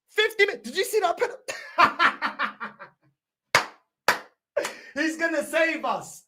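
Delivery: words tumbling out with a laugh; real laughter mid-sentence. Real, not acted. Laughing about penalty.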